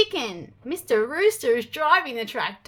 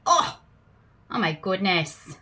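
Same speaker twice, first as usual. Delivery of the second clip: low, soft aghast aside; mock dismay; 6 semitones lower, 5 dB quieter than usual. Reacting to the fart story, a low mock-shocked aside. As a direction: groan it under your breath.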